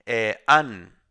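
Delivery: teacher isolating a syllable; syllable demo. Shown as a demonstration. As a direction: pick out the first syllable an and land on it. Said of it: "an es antepenúltima".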